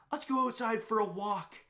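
high, put-on voice of a guy picking a fight; mock tough challenge. Gym guy inviting him outside to fight, high mock menace. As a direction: dare him to step outside.

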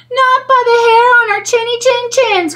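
very high squeaky pig voices; crying pigs. Acted. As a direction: cry out together like little pigs. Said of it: "cried the pigs".

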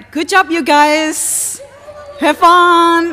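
loud, bright send-off; cheerful call-out. Real, not acted. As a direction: call it out across the room. Teacher calls out goodbye.

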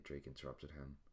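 ordinary delivery, nothing marked

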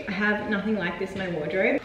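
ordinary talk

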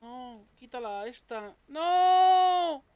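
slow plea then high loud scream; panicked scream. Real, not acted. About to get killed.

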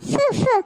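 two short puffs of breath; blowing. Acted. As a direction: blow on a burn to cool it. Blowing on burnt hands. (ஃபூ என ஊதுவது)

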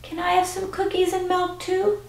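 high, very soft small voice; little boy in a sack. Acted. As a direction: pipe up timidly from inside the sack. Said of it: "there was another voice".

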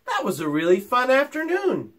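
lightly voiced cheerful boy; pleased boy. A narrator's reading. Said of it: "said the shepherd".

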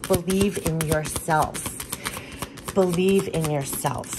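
slowly, each word of "Believe in yourself" given weight; slow emphatic mantra. Shown as a demonstration. Reader repeats the spirit message slowly to the viewer. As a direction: let the message sink in slowly.

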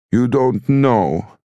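high, very slow narrated wail; sobbing mother. A narrator's reading. Mother sobbing to her son at supper, wailing. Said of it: "and then she was sobbing".

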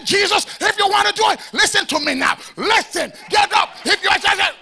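high, loud commanding prayer shout; hype preacher shouting. Shown as a demonstration. Pastor mocking theatrical prayer, high loud shouting. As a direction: command it at the top of your voice. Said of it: "Let's say".

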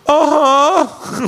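high, drawn-out cry of confusion; hammed-up high wail; mock dazed cry. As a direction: imitate a victim still in shock. Retold victim still not processing it.

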